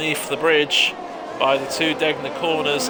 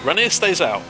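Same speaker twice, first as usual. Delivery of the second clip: excited; 7 semitones higher, 6 dB louder than usual. Real, not acted. Race commentator calls surprise strategy.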